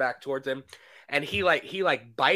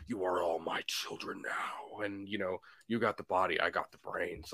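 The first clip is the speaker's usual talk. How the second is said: imitating Freddy; 11 semitones lower, 11 dB quieter than usual. Fan doing Freddy's line, low creepy whisper. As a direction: hiss it like Freddy Krueger. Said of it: "nobody could have done the".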